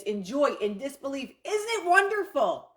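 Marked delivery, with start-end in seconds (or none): 1.4-2.7 much higher, louder cry of delight; joyful scream. Shown as a demonstration. Coach showing how to yell an affirmation, much higher and louder. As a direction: burst out with disbelieving joy. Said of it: "you can do that in the same way in happiness".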